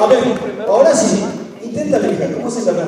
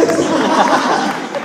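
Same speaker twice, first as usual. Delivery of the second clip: laugh; 6 semitones higher, 4 dB louder than usual. Real, not acted. Preacher laughing at the visitor.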